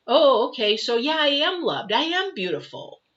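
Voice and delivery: joyful affirmation. Retold or imitated. The spirit tells the mind it is loved, elated affirmation. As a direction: realise you are loved and say it.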